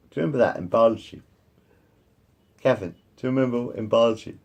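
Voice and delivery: ordinary talk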